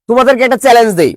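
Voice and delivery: ordinary delivery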